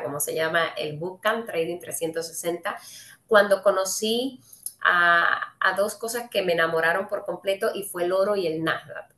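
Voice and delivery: ordinary delivery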